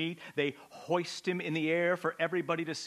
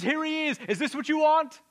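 high loud taunting shout; Pilate's taunting shout; 14 semitones higher, 9 dB louder than usual. Acted. Pilate shows the beaten Jesus to the crowd, shouting. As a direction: throw it at the mob in disgust. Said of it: "In other words".

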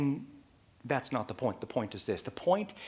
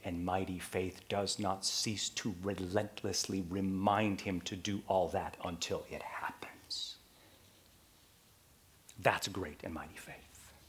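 hushed conclusion; 5 semitones lower, 6 dB quieter than usual. Real, not acted. Quiet closing of point.

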